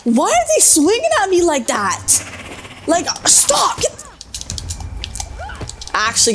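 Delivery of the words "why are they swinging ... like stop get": high-pitched, panicked protest; alarmed outburst. Real, not acted. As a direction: cry out at the attackers in alarm. Enemies swing at the player.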